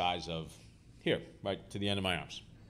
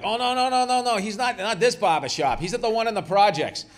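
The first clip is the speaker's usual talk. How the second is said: much higher and louder, overeager; mock innocent denial; 13 semitones higher, 9 dB louder than usual. Barbers sending reporters away, mock innocent. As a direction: play dumb, loudly.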